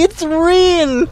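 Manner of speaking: high, loud, half-crying joy; overwhelmed shriek of joy; ecstatic scream. Real, not acted. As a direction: scream it with tears close. Shouting that the donation is real.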